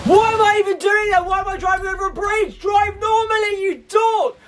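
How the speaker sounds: high, slow, wailing shouts at himself; frustrated yelling. Real, not acted. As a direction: scream at your own terrible driving. Driving badly, yells at himself.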